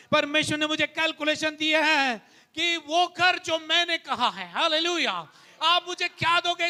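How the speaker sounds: rising pulpit fervour; fervent preaching. Acted. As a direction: preach it to the church with conviction. Preaching love over money.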